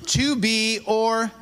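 stretched-out cue, waiting for the answer; fill-in-the-blank prompt. Shown as a demonstration. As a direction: draw it out for the crowd to finish. Cueing audience to finish phrase.

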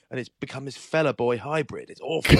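loud, high mock outrage at the text; hammed-up indignant yell; mock outraged shout. Friend mocked about fella boy, mock outraged shout. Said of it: "I said".

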